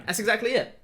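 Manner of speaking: ordinary delivery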